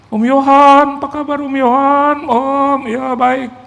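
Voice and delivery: high, loud, drawn-out hello to an uncle; eager pastor greeting. Acted. Pastor welcomes visiting Om Yohan, effusive high greeting.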